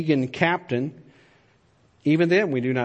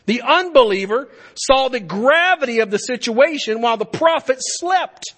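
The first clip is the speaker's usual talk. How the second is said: higher, louder emphatic reading; impassioned quotation; 9 semitones higher, 5 dB louder than usual. Acted. Reading the commentator's words, rising and louder. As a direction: preach the quote with rising passion. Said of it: "Frank Page wrote the following".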